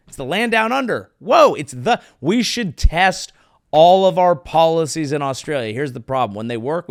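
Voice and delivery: ordinary delivery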